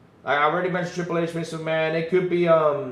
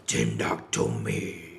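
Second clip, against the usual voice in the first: much lower, slow monster rumble; deep monster voice; 6 dB quieter, slower than usual. Retold or imitated. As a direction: let Godzilla rumble the reply. Godzilla answers again.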